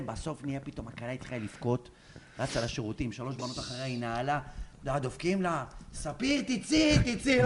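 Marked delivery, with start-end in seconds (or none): 5.9-7.4 high, drawn-out coaxing call; pleading friends. Retold or imitated. Girls knocking for the crying bride, high slow pleading. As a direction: imitate friends coaxing, pitched up and stretched.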